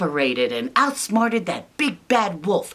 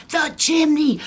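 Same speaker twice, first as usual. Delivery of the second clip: high loud alarmed shout; alarmed cry; 10 semitones higher, 4 dB louder than usual. Acted. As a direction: blurt it out in panic. Realization repeated.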